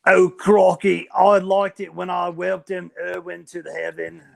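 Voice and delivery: hammed-up Australian Jesus; Australian accent. Jesus recalling welcoming Steve Irwin to heaven, Australian accent. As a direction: put on a loose Australian accent. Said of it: "I can do an Australian accent too".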